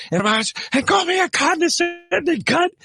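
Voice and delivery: high, drawn-out indignant yell; mock scream. Comic retells co-host's offence at an insult, mock screaming. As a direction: imitate an outraged man, voice way up. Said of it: "He's screaming at me".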